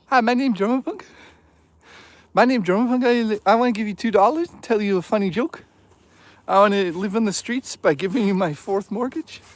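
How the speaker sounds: high goofy mock donor voice; silly high goofy voice. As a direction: ham it up like a dopey fan.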